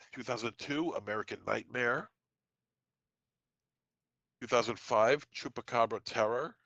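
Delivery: normal talking voice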